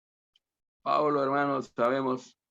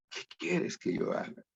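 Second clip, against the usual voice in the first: soft whisper of the question; 4 semitones higher, 8 dB quieter than usual. Shown as a demonstration. Preacher whispering the question to each listener. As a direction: breathe the words like a confession.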